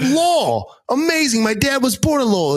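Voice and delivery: thick Boston, thrilled; Boston accent. Retold or imitated. Bonding with the driver, excited Boston accent. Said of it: "having a Boston moment".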